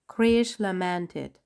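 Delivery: usual speaking voice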